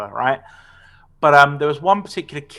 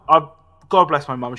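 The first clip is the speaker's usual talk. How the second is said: usual speaking voice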